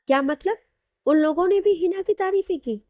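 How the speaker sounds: incredulous questioning; taken aback. Acted. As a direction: react in disbelief, pitch jumping up. Learns others praised Hina too.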